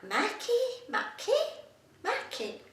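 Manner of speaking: high, puzzled echo of "Maggie" turning into "Mackie"; baffled mispronunciation. Retold or imitated. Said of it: "They're like".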